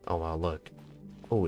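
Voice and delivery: ordinary delivery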